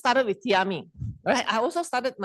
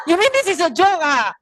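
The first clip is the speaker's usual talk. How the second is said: laughing disbelief; 6 semitones higher, 5 dB louder than usual. Retold or imitated. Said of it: "I say".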